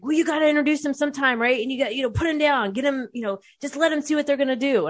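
high-pitched, agitated imitation of a brother; brother freaking out. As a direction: mimic a worked-up brother arguing his case. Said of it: "My brother starts freaking out".

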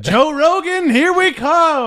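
high, loud cheer; excited shout. Acted. Cheering move to Texas.

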